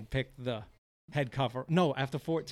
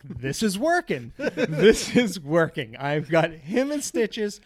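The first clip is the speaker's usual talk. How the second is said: louder, slow and laughing through the words; laughing gleefully; 4 semitones higher, 9 dB louder, slower than usual. Retold or imitated. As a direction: crow with glee while cracking up.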